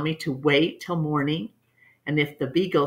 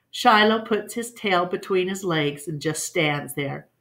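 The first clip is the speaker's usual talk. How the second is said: plain reading voice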